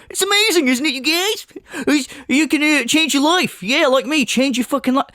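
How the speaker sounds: high, smug show-off voice; mocking smug gym bro. Mocking a smug streamer lecturing his viewers. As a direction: lift it up high and preen like a know-it-all. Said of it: "he's gonna sit here and go".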